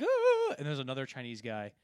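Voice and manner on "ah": a sudden, very high, loud shriek of shock; startled scream. Retold or imitated. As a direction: yelp as if caught in the act. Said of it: "and he's like".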